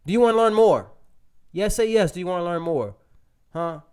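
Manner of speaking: host firing up live viewers; hyping the crowd. Real, not acted. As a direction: pump up the audience.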